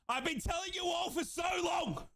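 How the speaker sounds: high, insistent fan's yell; vindicated shout. Real, not acted. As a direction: raise it high, shouting that you knew. Repeats, building to a shout.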